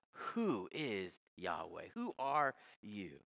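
low, slow, scornful sneer; demeaning put-down of a lesser god; sarcastic contempt. Shown as a demonstration. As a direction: drag out "Who" with mocking disdain. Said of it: "Can you hear the sarcasm? Can you hear the demeaning tone?".

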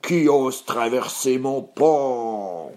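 long drawn-out troll growl; menacing beast, very slow. Acted. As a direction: growl it slowly, stretching each word. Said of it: "grogna la bête".

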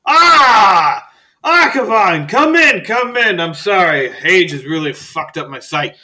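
put-on very high, loud delighted old man cry; Faust welcoming. Old librarian greeting his friend, delighted shout. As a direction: throw the door wide, thrilled to see him. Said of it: "And he goes".

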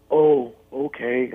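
slow, deflated "oh, okay"; disappointed. Retold or imitated. [name] hearing [name] can't come to the show, slow and let down. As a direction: let the letdown show, slowly.

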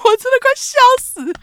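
can barely talk for laughing; laughing hard. Real, not acted. Host cracking up at her friend's prank, laughing hard.

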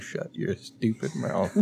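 quiet. Retold or imitated. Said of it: "I'm like".

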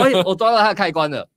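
high, gleeful aha; gleeful discovery. Retold or imitated. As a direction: crow over finding his weak spot. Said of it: "我说".

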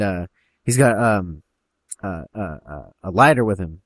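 usual speaking voice

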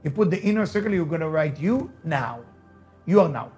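ordinary talk, nothing marked

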